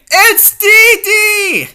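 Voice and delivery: high, loud drawn-out correction; indignant shout. Acted. Dee Dee shouts to correct the servant. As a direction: yell the name back, offended.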